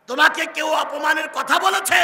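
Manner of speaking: high shouted demand; urgent question. Acted. Son pressing his weeping mother, loud and high. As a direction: insist on knowing, voice ringing.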